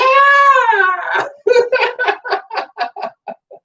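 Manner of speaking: high loud laughing shriek; shrieking laughter; laughing fit. Real, not acted. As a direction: let go into a full laughing fit. Minute of laughter begins.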